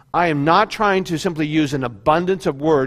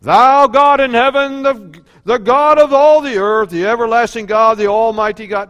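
put-on Old English prayer; 5 semitones higher, 8 dB louder than usual. Preacher imitating formal King James style praying, grand. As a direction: intone it like a stately old prayer. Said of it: "Old English praying".

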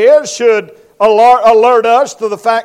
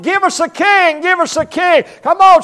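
higher, insistent pleading for a king; whiny demand; 6 semitones higher than usual. Retold or imitated. Israel keeps demanding a king, higher and whinier. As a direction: nag Samuel like a spoiled crowd. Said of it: "You don't want".

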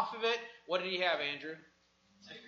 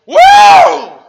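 high, loud shouted whoop; fired-up whoop; excited whoop; 27 dB louder than usual. Real, not acted. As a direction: let out a wild preacher's whoop. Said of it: "five-alarm fire".

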